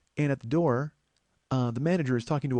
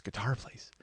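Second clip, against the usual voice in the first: muttered slip; 10 dB quieter, slower than usual. Shown as a demonstration. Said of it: "No, it's a burger place".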